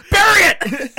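high, loud comic outburst; mock shouted order. Director cutting off the actor's protest, mock shouted order. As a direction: bellow the command over the laughter.

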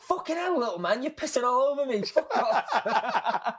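high-pitched protest, playing the splashed victim; high, hammed-up indignation; acted indignant outrage. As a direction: exclaim it in mock outrage, pitched well up.

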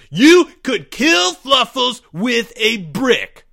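slow, high, furious accusing shout. A narrator's reading. Greg cuts off Albert's confession, shouting. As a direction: shout every word out, high and loud. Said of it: "shouted Greg".

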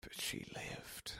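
hushed, slow whisper. Real, not acted. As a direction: whisper it slowly, barely audible. Quiet aside insisting on survival.

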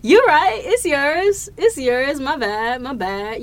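hasty mock apology, high and loud then easing; comic backing down. Retelling own retreat after her answer, comically apologetic. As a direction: concede at once, settling down by the end.

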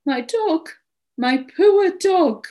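high, drawn-out wail over the dog; distressed lament. A narrator's reading. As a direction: lament the lost pet, building on the second phrase. Owner laments her lost dog.

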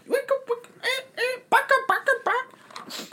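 squeaky high bird chatter; high bird squawk. Pretend.

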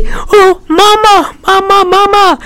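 very high mock child voice; little Timmy crying for mama. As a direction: wail for mama like a small boy. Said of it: "Hear the little Timmy go".

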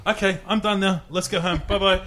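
high, breezy mock sign-off; lightly voiced chef. Imitating the chef suddenly ending karaoke, high and breezy. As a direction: toss it off cheerily in her voice. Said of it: "And she goes".

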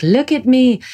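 high, attention-grabbing siren call. Retold or imitated. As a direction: call out high, like pain luring you in. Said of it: "It's like the siren".

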